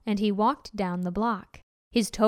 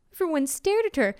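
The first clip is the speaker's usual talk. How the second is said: ordinary delivery, nothing marked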